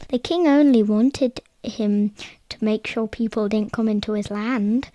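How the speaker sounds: usual speaking voice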